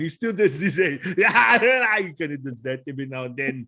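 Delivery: Austrian action-star impression bursting into a yell; mimicked strongman's wild outburst; Arnold Schwarzenegger. Shown as a demonstration. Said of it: "So when I do Arnold".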